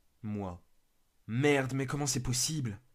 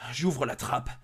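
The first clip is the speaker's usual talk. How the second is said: ordinary delivery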